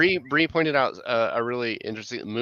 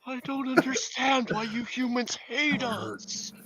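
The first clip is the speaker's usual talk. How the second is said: high, slow, soft cloud voice; a wounded cloud asking why; plaintive cloud voice; 12 semitones higher, 4 dB quieter, slower than usual. Pretend. Cloud voice pleading.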